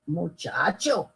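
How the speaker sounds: put-on drawn out like a patient old man; old carpenter calming the boy. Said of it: "said the old carpenter".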